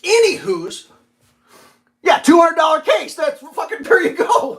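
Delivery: high, loud laughing outburst; laughing shout about the case; laughing exclamation. Real, not acted. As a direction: crack up at the price.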